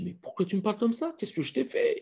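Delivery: high, wounded protest; plead, baffled and hurt; hurt friend. Acted. Snubbed friend asking why, high and hurt. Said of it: "il dit".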